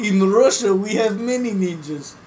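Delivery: put-on high, loud joke Russian accent; loud comic Russian accent; mock Russian accent. Joke Russian character about ninjas.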